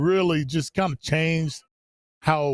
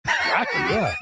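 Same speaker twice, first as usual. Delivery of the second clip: excited shout; slower than usual. Retold or imitated. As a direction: shout it like a big fan. Excited reply to Nike idea.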